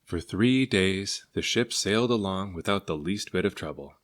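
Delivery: ordinary delivery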